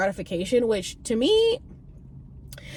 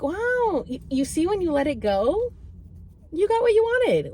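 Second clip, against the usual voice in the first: bright praise; 9 semitones higher than usual. Retold or imitated. Trip voice celebrating.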